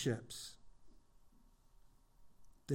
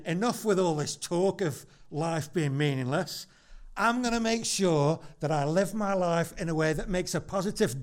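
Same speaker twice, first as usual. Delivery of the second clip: builds louder and higher, peaking on change the world; idealist's rousing vow; 4 semitones higher, 6 dB louder than usual. Retold or imitated. An idealist's rousing pledge to congregation, building excitement. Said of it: "some people might say".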